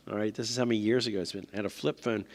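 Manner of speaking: usual speaking voice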